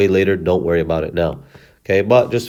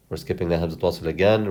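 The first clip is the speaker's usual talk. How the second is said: normal talking voice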